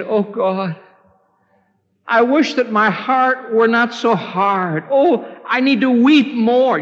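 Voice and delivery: high, pleading cry to God; acted penitent's plea, pitched high; anguished prayer. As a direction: pray it as a heartfelt lament. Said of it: "Have you ever said".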